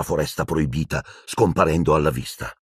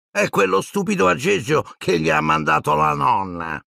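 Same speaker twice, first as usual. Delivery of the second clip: higher, contemptuous brat voice; scornful sneer; 7 semitones higher than usual. Acted. Malfoy sneers at Neville's gift from his grandmother.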